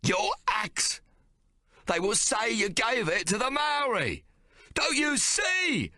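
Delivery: high, slow desperate shouting; Hawk frantic. A narrator's reading. Hawk warning his brother, high desperate shout. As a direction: hammer the danger home.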